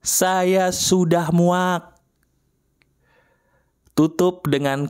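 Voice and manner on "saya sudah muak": modelling the words of disgust for the listener; very slow fed-up line. Shown as a demonstration. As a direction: draw out "Saya sudah muak" very slowly. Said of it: "Teriak, silakan".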